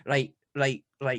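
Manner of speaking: imitated Scottish accent on short repeated words. Mum on the phone to her dad, imitated Scottish accent. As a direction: mimic mum putting on Scottish. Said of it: "she would put on a Scottish accent".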